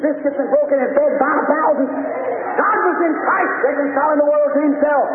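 high, sustained sermon chant; fervent preaching. Real, not acted. Preacher telling the congregation of Lazarus's grave, fervent preaching. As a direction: preach in a driving, near-monotone cry.

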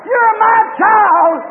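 put-on mother eagle claiming her child from the barnyard; mother eagle's shout.

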